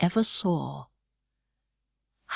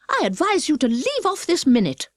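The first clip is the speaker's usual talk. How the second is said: higher, firm, bossy self-reproach; sharply; 8 semitones higher than usual. A narrator's reading. Alice ordering herself to stop crying, sharp and bossy. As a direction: tell yourself off like a prim governess. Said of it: "said Alice to herself rather sharply".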